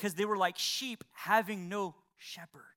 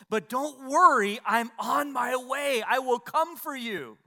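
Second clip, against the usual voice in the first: louder, higher, slow comforting promise; Jesus promising his return, slow and raised; reassuring Jesus; 5 semitones higher, 7 dB louder, slower than usual. Acted. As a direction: call down gently as Jesus keeps his word. Jesus voiced reassuring the suffering.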